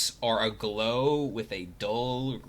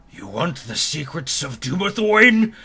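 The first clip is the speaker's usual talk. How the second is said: booming ghost voice, slowing at the end; a ghost guarding a secret; ghostly voice; 5 semitones higher, 8 dB louder than usual. Acted. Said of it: "In a very ghostly voice you hear".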